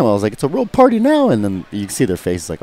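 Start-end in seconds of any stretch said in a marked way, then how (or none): 0.3-1.3 high, sing-song sarcastic welcome; mocking taunt. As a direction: tease them like it's a party. Taunting the rivals.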